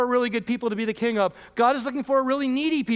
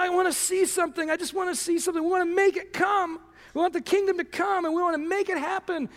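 impatient pleading; 6 semitones higher than usual. Pretend. Mock impatient believers' voice.

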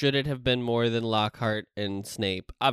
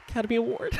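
mock meme voice; 9 semitones higher, much faster than usual. Voicing the meme woman.

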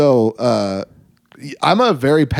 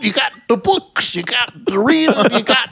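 Bill Cosby impression; 8 semitones higher than usual. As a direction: drag it out like Cosby. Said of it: "him going".

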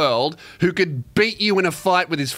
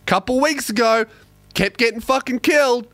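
ordinary talk, nothing marked

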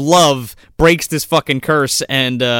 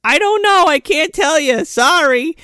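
much higher, helpless apologetic voice; flustered aunt; 3 dB louder than usual. Retold or imitated. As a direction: shrug it off like a useless aunt. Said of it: "She's like".

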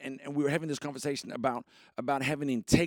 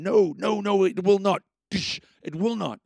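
louder, higher, firm denial; emphatic no; 4 semitones higher, 7 dB louder than usual. Real, not acted. Host rejecting a passive attitude, emphatic and loud. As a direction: slam the door on that idea.

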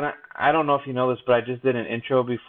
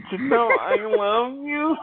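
very high, slow squeal of love; high pitched; 12 semitones higher, slower than usual. Shown as a demonstration. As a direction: float the line way up high. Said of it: "No, it has to be like".

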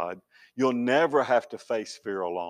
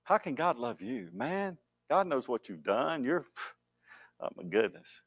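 mocking tempter; 6 dB quieter than usual. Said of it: "heard Satan say to you".

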